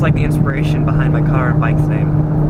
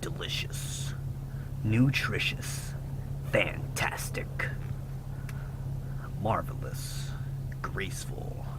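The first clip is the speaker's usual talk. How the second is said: low, hushed trailer narrator; breathy movie announcer, half whispered; movie trailer guy voice; 5 semitones lower, 18 dB quieter, much slower than usual. Pretend. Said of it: "the movie guy voice".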